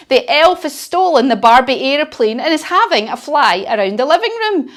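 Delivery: ordinary talk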